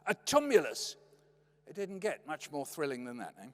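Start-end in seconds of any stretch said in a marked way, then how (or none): 0.1-1.0 imitated fatherly excitement, a little louder; mock-thrilled dad; excited dad. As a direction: announce it like a thrilling find. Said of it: "The old man was still excited".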